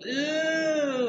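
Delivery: high teasing yelp; mock ew. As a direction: pretend to be grossed out. Mocking reaction to I love you.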